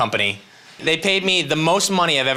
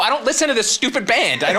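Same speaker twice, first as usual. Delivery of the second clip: much higher, mock indignant; snippy retort; 10 semitones higher than usual. Comedian snapping back at teen fans, mock indignant. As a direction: toss back a petty comeback.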